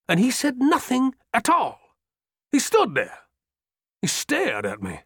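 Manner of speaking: high, urgent, indignant officer's voice; a breathless old soldier venting his fury; agitated old general. Acted. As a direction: pour it out, outraged and pleading. Trimble retelling his plea to Ewell.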